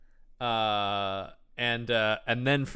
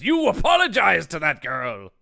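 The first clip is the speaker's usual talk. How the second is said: slow, emphatic heroic order; acted stern demand; 3 semitones higher, 4 dB louder than usual. Hans confronting the bullies for the girl, stern acted demand. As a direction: draw out the command with mock indignation.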